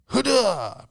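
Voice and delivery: high, loud war cry; mock battle cry. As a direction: bark it like a guard about to strike.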